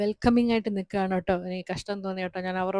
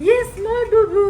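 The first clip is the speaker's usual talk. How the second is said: much higher and louder yes; excited cheer; 14 dB louder, slower than usual. Real, not acted. Mother cheers her toddler's small bandage, high and loud.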